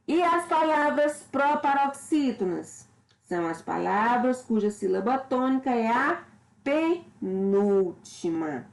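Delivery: normal talking voice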